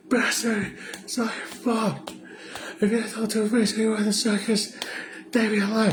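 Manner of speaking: squeaky mock Robin lashing out with a laugh; high Robin voice. Mocking Robin yelling at Bruce, high put-on voice.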